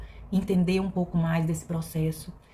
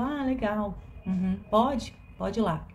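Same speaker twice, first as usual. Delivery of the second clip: detached, absent-minded replies, a touch higher at first; cold and distant; 3 semitones higher, slower than usual. Retold or imitated. Mother brushing off her daughters, coldly distant. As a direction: answer the kids as if barely listening. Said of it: "totalmente distante, sabe? Assim, fria".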